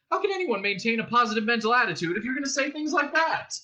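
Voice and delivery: high, agitated complaint; exasperated protest. A narrator's reading. Prisoner to the guard spoiling his optimism, exasperated. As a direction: plead with rising exasperation.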